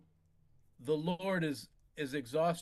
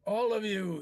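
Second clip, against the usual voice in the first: higher, louder command; commanding call; 7 semitones higher, 7 dB louder than usual. Acted. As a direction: call them all to order. Dying lord commands.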